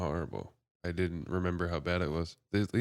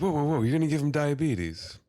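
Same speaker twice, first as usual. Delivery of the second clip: loud, raised-pitch protest; mock alarm; 7 semitones higher, 5 dB louder than usual. Father warning a woman off his baby, mock alarm. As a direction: burst out as if stopping a stranger.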